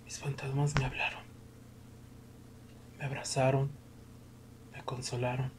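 hushed whisper. Acted. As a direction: whisper it like a secret. Whispered ghostly memory.

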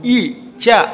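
ఈ చ with the tongue touching the palate; palatal sounds. Shown as a demonstration. Teacher showing listeners the palate letters, ఈ చ sounded on the palate. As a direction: sound ఈ and చ separately against the palate. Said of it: "ఈ తాలు పైకి తగులుతుంది".

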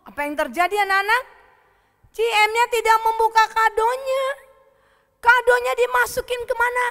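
put-on loud, high, excited questions to the kids; dramatic storytelling. A narrator's reading. Asking children where the gift went, loud and dramatic. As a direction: announce the shocking turn at full voice.

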